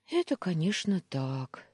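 ordinary delivery

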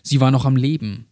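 ordinary delivery

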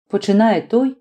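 ordinary delivery, nothing marked

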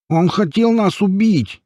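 high, drawn-out honking protest; slow, high-pitched complaint; nasal whine. A narrator's reading. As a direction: whine it through a bloodied nose. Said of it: "прогундосила кровавая туша".

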